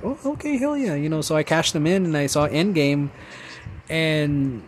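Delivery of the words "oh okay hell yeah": delighted hell yeah. Retold or imitated. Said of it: "So I'm like".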